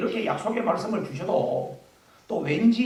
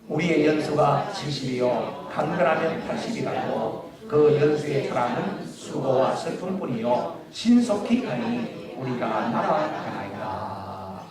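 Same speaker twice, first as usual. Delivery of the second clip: slow, measured recitation; solemn slow Bible reading; scripture reading; 6 semitones lower, slower than usual. Real, not acted. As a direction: read the psalm aloud with the congregation. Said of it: "다 같이 시작".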